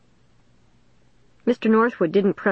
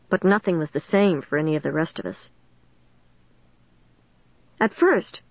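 usual speaking voice

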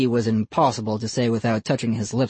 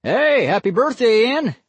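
hearty man calling out; loud friendly greeting; cheerful uncle; 10 semitones higher, 4 dB louder than usual. A narrator's reading.

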